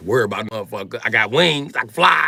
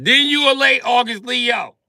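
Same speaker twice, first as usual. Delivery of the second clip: high, loud pronouncement; loud mock verdict; 11 semitones higher, 7 dB louder than usual. Comic telling late Leo their fate, loud high verdict. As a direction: declare it like breaking bad news loudly.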